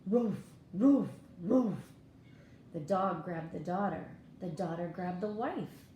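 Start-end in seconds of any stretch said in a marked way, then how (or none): 0.1-1.7 loud barking dog voice. Acted. Dog answering the call, loud barking voice. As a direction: bark it out loud.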